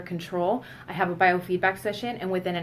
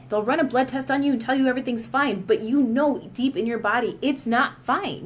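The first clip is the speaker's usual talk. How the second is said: ordinary talk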